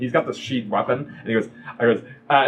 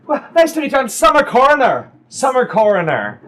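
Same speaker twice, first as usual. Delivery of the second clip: self-introduction; 11 semitones higher, 6 dB louder than usual. Acted. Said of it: "she goes, goes".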